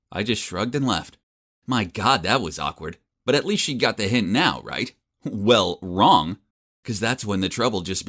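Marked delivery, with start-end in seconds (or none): none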